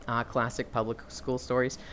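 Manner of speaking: ordinary talk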